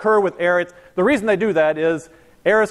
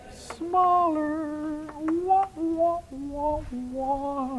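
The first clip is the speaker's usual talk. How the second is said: high, soft mocking wah-wah like a sad trombone; 10 semitones higher, 7 dB quieter, much slower than usual. Teacher mocks the shrinking pressure with a high, soft wah wah.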